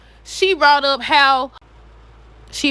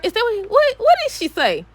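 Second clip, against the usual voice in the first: ordinary delivery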